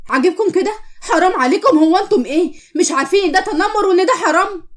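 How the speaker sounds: high, indignant telling-off; outraged scolding. Acted. May scolds classmates for bullying Qamar, outraged. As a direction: shame them with angry questions.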